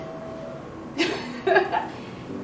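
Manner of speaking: mocking laugh. Taunting laugh mid-boast.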